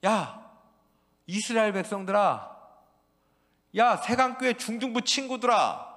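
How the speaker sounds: loud, high prophet calling out; prophet's cry. Acted. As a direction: call the people back to God at full voice. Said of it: "그래서 계속 외쳤죠".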